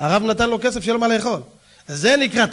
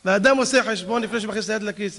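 ordinary talk, nothing marked

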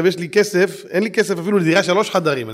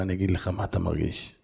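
soft reply; 8 semitones lower, 7 dB quieter than usual. Retold or imitated. Speaker softly explains.

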